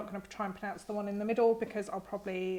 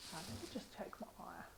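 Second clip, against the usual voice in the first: very soft, quick, voiceless murmur; whispered aside; 2 semitones lower, 19 dB quieter, faster than usual. Real, not acted. Muttered aside while checking her eye.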